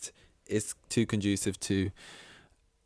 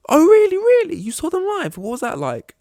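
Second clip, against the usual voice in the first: squeaky, much higher excited stranger; eager stranger; 11 dB louder than usual. Retold or imitated. Imitating the new coworker's excited questions, high and squeaky. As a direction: gush like a wide-eyed new colleague.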